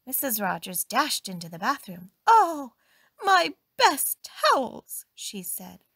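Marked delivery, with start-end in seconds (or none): 2.3-5.1 high, loud, drawn-out dismay; dismayed outcry. A narrator's reading. Employer finds her best towels spoiled, high loud dismay. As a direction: wail it slowly, aghast.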